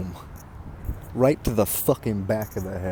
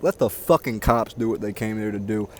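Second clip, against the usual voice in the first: normal talking voice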